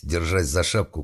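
plain reading voice

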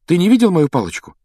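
high, loud, quick question; anxious question. A narrator's reading. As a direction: ask urgently, on edge. Harry anxiously seeks his wand. (встревоженный торопливый вопрос)

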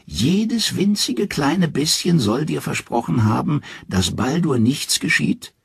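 viel höher und langsam, dünn gepfiffen; dünne pfeifende Stimme. A narrator's reading. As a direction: die Alte dehnt ungläubig. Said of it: "mit dünner, pfeifender Stimme". (Loki als Alte zweifelt, dünn und hoch)

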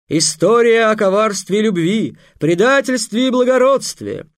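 loud, high, grand showman announcement; booming circus ringmaster. Acted. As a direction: proclaim it to a big crowd, slowed on titles. (громкий торжественный конферансье)

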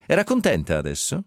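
ordinary delivery, nothing marked

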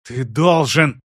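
insistent, stress on должен. Acted. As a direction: hit the word должен hard. Sister insists on demand.

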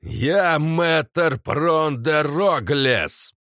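slow, loud, high declaration; booming proclamation. Acted. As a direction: announce yourself to the enemy. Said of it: "раздался не менее могучий глаз".

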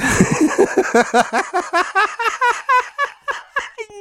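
shrill witch cackle; high evil cackle. Acted. Ulrica gloats over knight.

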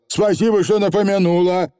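high, chirpy thanks; unabashed Feegle. A narrator's reading. Feegle thanking the witch, high and unabashed. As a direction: shameless little rogue. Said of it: "ничуть не смутился Явр заядло".

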